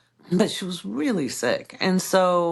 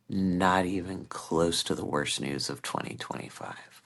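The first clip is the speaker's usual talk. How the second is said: snarky retort; 12 semitones lower, 4 dB quieter than usual. Retold or imitated. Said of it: "so tempted to make a video going".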